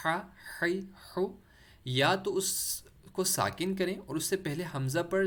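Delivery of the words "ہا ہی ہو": ہا، ہی، ہو with pharyngeal H, pitched higher; careful higher-pitched drill of ہا، ہی، ہو; mid-throat ha drill. Shown as a demonstration. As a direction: squeeze each H from mid-throat. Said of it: "زبر زیر پیش لگا کر".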